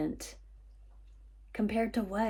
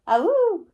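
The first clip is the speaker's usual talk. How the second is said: high loud coyote howl; wolf voice; 12 semitones higher, 9 dB louder than usual. Retold or imitated. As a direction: throw back your head and howl like a coyote. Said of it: "She does not howl".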